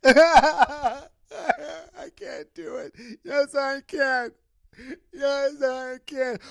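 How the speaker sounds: high, hammed-up tearful wail; mock crying. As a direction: wail through running tears, playing it up. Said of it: "That's tears streaming down my face".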